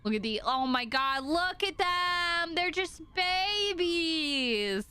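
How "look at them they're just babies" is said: high, slow, drawn-out gushing; doting coo. Real, not acted. Viewer gushing over the chicks, doting coo. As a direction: coo over tiny babies, stretching the words.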